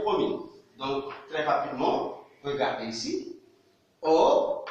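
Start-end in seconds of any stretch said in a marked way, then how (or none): 4.0-4.6 O held out as a model sound; O said clearly. Shown as a demonstration. Teacher modelling the vowel O. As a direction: say the letter O for the class. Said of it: "la voyelle O".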